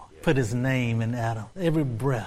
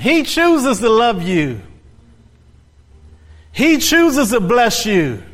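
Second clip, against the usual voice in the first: high, loud rhythmic build of praise; full-force preaching; 8 semitones higher, 9 dB louder, slower than usual. Real, not acted. Preacher extolling God to the congregation, at full force. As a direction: proclaim each attribute, climbing to a held cry.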